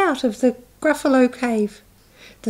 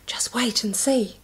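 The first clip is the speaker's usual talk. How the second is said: whispered and much softer; secret whisper; 3 semitones lower, 8 dB quieter than usual. Acted. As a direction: breathe it like a secret plan.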